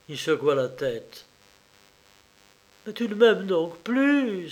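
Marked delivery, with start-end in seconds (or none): 2.9-4.5 sobbing. A narrator's reading. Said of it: "sanglota Varvara".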